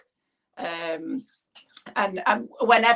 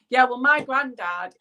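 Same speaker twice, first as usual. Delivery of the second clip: usual speaking voice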